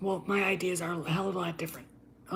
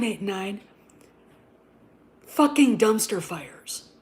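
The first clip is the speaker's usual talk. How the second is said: ordinary talk, nothing marked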